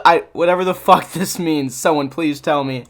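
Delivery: ordinary talk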